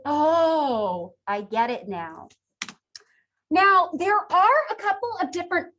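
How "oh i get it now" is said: drawn-out "Oh", then dropping lower; sudden realization. Acted. Listener suddenly getting the joke's punchline, acted realization. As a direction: act out the penny dropping. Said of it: "all of a sudden".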